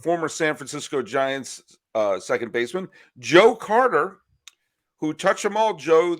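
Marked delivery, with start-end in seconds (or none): 3.2-6.2 loud, high home run call; imitating announcer call. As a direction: belt it like a radio play-by-play. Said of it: "the Blue Jays announcer said that".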